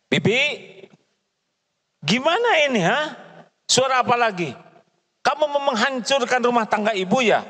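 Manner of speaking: high, scolding outburst at the maid; angry mistress of the house. Acted. Employer scolding maid over broken plate, angrily. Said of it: "Marah atau tegas?".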